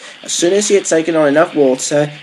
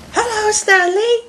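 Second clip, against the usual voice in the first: put-on very high, slow child voice; high-pitched kids' hello; children's greeting; slower than usual. A narrator's reading. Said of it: "said Stephen and Bridget".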